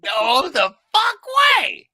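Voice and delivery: loud, slow, stretched-out shout of disbelief; disbelieving shout. Real, not acted. Podcast host hearing about the elevator prank, shouting in disbelief. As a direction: yell it in real disbelief, word by word.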